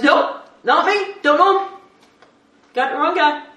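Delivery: emphatic denial. Acted. Peter to the bystander, loud emphatic denial. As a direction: shut it down hard.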